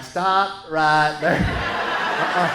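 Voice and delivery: slow, deliberate and hard; slow firm stop. Retold or imitated. Husband stopping wife's Valentine list, slow and firm. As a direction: halt her mid-sentence, word by word.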